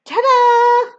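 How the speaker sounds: high, loud showy ta-da; excited fanfare, drawn out. A narrator's reading. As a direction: present it like a grand reveal. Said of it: "I do jazz hands excitedly".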